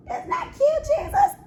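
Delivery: very high cooing squeal; squeaky high-pitched gush; cute squeal. Real, not acted. Cooing over raccoon paw prints.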